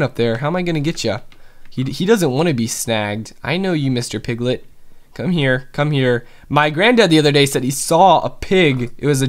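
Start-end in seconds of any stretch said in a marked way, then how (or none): none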